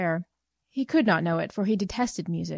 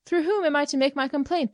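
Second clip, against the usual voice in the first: indignant question; 8 semitones higher than usual. Acted.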